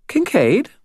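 high, playful questioning lilt; whimsical child. A narrator's reading. Girl teasing about her driver, whimsical and high. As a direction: tease with the name. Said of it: "her voice whimsical".

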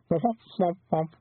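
high, mournful creature babble; sad woofing. A narrator's reading. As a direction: woof it like a dejected farewell.

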